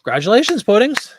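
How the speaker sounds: loud, high congratulations; excited cheer. Real, not acted. Congratulating viewer Puddings on a sale, loud excited cheer.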